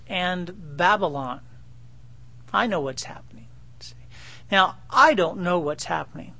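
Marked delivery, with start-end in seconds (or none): 2.5-3.9 hushed confiding whisper from God. Acted. Pastor voicing God, whispering assurance to Jeremiah. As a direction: lean in and breathe it like a secret.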